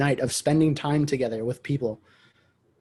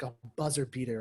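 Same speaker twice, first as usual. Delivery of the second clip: ordinary talk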